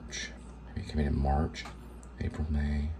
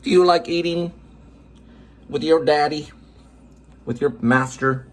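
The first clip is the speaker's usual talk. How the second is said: high pet-talk voice; 7 semitones higher than usual. Real, not acted. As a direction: coo sweetly at the cat, higher. Baby talk to cat.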